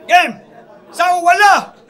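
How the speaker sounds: loud crowd-pumping MC; hype host shouting. Acted. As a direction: pump up the crowd. Host hypes the rap battle crowd.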